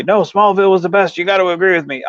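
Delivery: insistent fan. Retold or imitated. Imitating fans who demand agreement on the show, insistent. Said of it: "some people will say".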